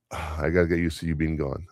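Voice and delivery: usual speaking voice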